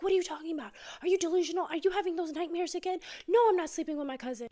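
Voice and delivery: mock indignant denial, pushing back hard; defensive denial.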